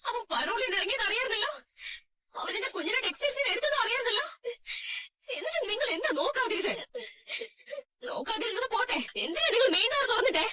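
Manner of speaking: high-pitched crying rage; distraught, halting reproach; tearful accusation. Acted. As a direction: hurl blame through sobs. Mother accuses crying grandmother.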